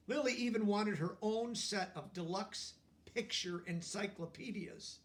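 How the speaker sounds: plain reading voice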